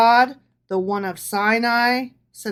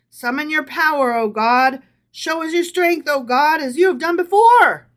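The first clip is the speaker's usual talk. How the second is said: building proclamation; 7 semitones higher, 11 dB louder than usual. Acted. Rising proclamation of the psalm.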